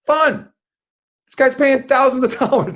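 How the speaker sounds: bursting out high and loud; excited exclaim. Real, not acted. Magician tells host the key to parties, high excited burst. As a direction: fling the word out with real glee.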